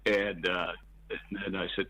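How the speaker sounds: ordinary delivery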